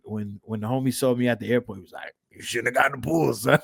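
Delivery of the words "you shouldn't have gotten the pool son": lightly imitated raspy smoker; a hint of a hoarse New York coworker. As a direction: put on a slight smoker's rasp. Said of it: "He got raspy voice".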